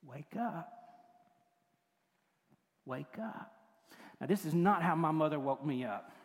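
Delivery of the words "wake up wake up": soft, hushed gentle waking; tender wake-up. Acted. Jesus waking the little girl, tenderly hushed. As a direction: coax a sleeping child awake, very softly. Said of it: "He just leaned over and said".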